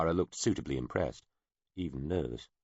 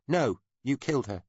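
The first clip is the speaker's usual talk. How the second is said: put-on child accusing; 9 semitones higher, 3 dB louder than usual. A narrator's reading.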